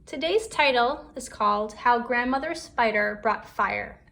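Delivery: ordinary delivery, nothing marked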